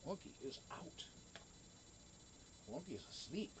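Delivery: soft unvoiced whisper so she sleeps; hushed whisper, don't wake her; whispering over a crib. Real, not acted. Wonky asleep nearby, whispering.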